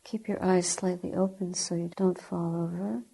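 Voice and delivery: ordinary talk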